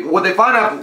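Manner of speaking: ordinary talk, nothing marked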